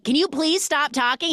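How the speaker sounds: high, exasperated retold outburst; pissed off. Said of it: "He's, like, so pissed".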